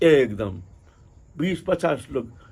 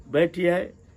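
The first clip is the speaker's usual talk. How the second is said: ordinary talk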